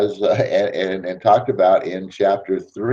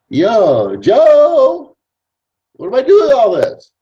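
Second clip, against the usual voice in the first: much higher and louder, a mock panicked call; calling out after Joe; 13 semitones higher, 7 dB louder, slower than usual. New recruit calling after the sponsor who left, mock panicked call. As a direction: holler after him like he's vanished.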